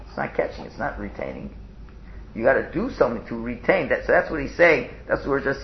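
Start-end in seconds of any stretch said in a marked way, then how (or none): 0.1-1.5 hushed repeat. Shown as a demonstration. Teacher echoing his point to the class, hushed whisper. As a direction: drop to a near whisper for the echo. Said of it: "but it's not catching".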